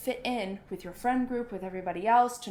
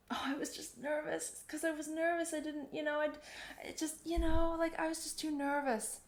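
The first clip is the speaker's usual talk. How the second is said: higher, softer, mock-pleading excuses; whiny nervous excuse; 7 semitones higher, 4 dB quieter than usual. Musician excusing a mess-up on stage, lightly whiny and higher. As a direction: play the performer hiding behind nerves, a touch whiny. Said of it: "you will always say".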